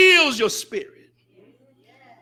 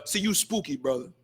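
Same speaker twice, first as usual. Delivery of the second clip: much softer and lower mocking jeer; mock scoffing brother; 8 semitones lower, 12 dB quieter than usual. Preacher imitating Muslims brushing off strictness, soft scoffing. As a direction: tease like a dismissive brother. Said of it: "we have the".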